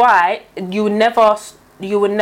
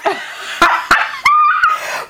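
shrieking laugh. Real, not acted. Mocking the crying commenter with a shrieking laugh. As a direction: let out a screaming laugh. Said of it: "Nah, laugh".

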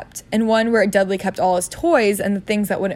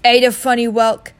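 louder uncle voice; Uncle Vernon; 3 semitones higher, 7 dB louder than usual. Acted. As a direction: read it out loudly. Uncle reading postcard aloud.

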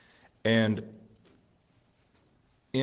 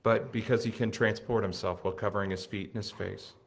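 normal talking voice

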